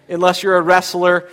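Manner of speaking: ordinary talk, nothing marked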